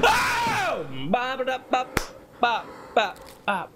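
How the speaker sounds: a high startled yell into nonsense syllables; scared gibberish. Real, not acted. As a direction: blurt out panicked babbling. Scared outburst then gibberish.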